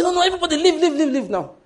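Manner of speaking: urgent warning. Retold or imitated.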